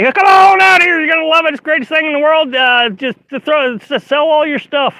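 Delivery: high, loud, hyped-up sales pitch; mock salesman pitch. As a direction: oversell it like a pushy promoter. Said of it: "'cause I don't say".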